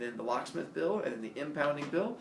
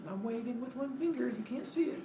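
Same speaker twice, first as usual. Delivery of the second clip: high mock reply; 10 semitones higher, 4 dB quieter than usual. Handcuffed student to gawking friends, high mock reply. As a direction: squeeze it up high, as if calling back slyly.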